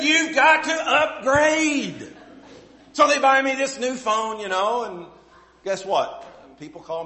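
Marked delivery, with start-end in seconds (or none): 0.0-2.2 loud, high, laughing and drawn out; hammed-up teasing son insisting; laughing son's verdict. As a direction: son laughing at his dad's old phone, loud and high. Son telling dad to upgrade.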